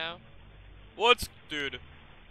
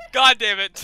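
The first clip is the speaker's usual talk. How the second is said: startled curse; 7 semitones higher, 12 dB louder, slower than usual. Real, not acted. After jumpscare.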